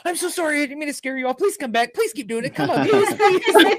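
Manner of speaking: high, laughing mock plea; pleading. Retelling Shelly begging the ghost, high and laughing. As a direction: beg the ghost sweetly to come back.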